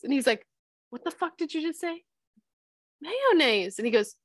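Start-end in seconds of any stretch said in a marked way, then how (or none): 0.9-2.0 high, hushed incredulous question; acted-out aghast friend; mock disbelief. 3.0-3.8 "Mayonnaise" as MAY-oh-naze, high start, falling; high, innocent-sounding quoted "Mayonnaise"; imitated pronunciation. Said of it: "That's not how you say that either".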